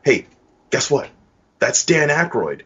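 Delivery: high, bright fake enthusiasm to a kid; mock cheery parent. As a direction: sell it like an overeager parent changing the subject. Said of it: "just go".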